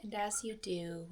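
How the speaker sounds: soft, unhurried meditation voice with long pauses; soft calm phrases, spaced out by silence; guided meditation. Acted. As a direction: guide listeners gently through each breath. Leads a guided breathing meditation.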